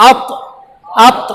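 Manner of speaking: "আত" with a bounce on the final heavy t; qalqalah bounce. Shown as a demonstration. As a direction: let the last consonant of "আত" drop and rebound. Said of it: "শেষে একটা ওই বলে drop খায়". ("আত"-এর শেষে কলকলার drop)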